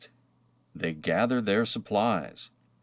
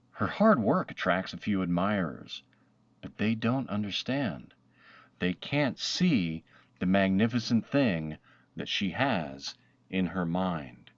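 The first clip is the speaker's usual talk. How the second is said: ordinary delivery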